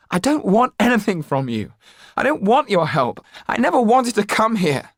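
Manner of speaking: shouting. Acted. Jan shouts at the Laxtons rejecting their help. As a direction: yell through tears. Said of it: "Jan was shouting now".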